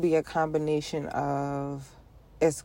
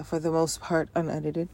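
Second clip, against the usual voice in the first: ordinary delivery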